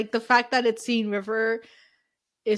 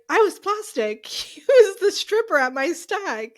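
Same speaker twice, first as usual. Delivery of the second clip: higher, hammed-up flustered Rory voice; mock Rory rambling to his mother-in-law, higher and laughing; 8 semitones higher, 4 dB louder than usual. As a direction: blurt it out like panicked Rory, half laughing. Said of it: "He's like".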